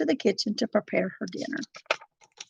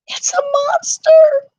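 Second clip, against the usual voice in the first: whispered; 8 dB louder than usual. A narrator's reading. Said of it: "whispered one dwarf".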